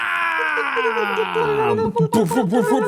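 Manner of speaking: high, drawn-out shouted laugh; high whooping laugh. Pretend. Host cackling after his DJ bit with a high shouted laugh.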